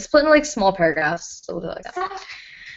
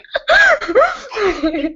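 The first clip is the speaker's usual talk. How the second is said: high, helpless laughing fit; uncontrolled high-pitched laughter; real hysterical laugh; 12 semitones higher, 4 dB louder than usual. Real, not acted. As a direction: burst out laughing, squealing high. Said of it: "I need to calm down".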